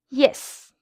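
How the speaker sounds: "Yes" starting high and falling sharply; short, decisive falling "Yes"; very firm yes. Shown as a demonstration. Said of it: "imagine saying a very firm yes".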